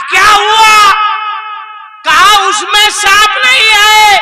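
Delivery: high, loud and urgent; agitated shouting. Acted. Old woman and snake charmer shouting in alarm.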